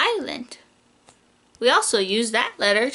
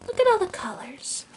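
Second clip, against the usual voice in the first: whisper; 4 semitones higher, 5 dB quieter than usual. Acted. Reading alphabet book to child.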